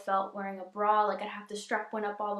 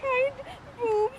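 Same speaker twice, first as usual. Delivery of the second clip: blubbering; 13 semitones higher, 3 dB louder than usual. Real, not acted. Crying after surgery reveal.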